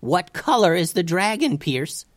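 high, insistent question; exasperated demand. A narrator's reading. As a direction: press a panicking friend for an answer. Yelled exchange with panicking friend continues.

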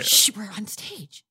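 urgent slow whispered shush; whispered warning; stage whisper. Acted. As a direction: hush your partner before the audience hears. Hushing partner on stage.

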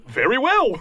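high creature voice; agreeable Kenku. Acted. Kenku agreeing to steal a sword, high creature voice. Game master answers as Kenku.

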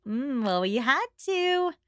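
high, slow catty dig; a catty society lady needling Jane, pitched high; 1 snide Mrs Elton. Retold or imitated. Friend mocks Mrs Elton's snide remark to Jane in a high catty voice.

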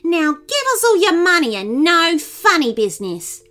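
high, loud threatening demand; robber's yell. A narrator's reading. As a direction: demand cash from a shop clerk. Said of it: "yelled Nin the robber".

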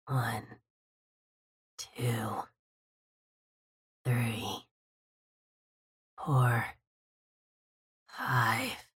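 low, soft wheezing whisper; wheezing count. A narrator's reading. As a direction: count slowly in a wheezy hush. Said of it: "I hear a wheezing voice".

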